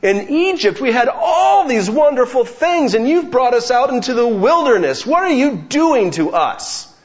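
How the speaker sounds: high, loud whining shout at Moses; high and loud, the Israelites yelling their complaint at Moses; grumbling Israelites. Retold or imitated. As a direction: rail at Moses as if dying of hunger. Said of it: "Moses and they say".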